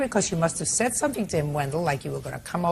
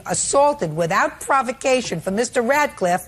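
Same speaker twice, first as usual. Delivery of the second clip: higher-pitched, emphatic indignation; incredulous rebuke; 4 semitones higher than usual. Real, not acted. Judge confronting mother about sleeping with accuser, incredulous. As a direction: hammer the point home in disbelief.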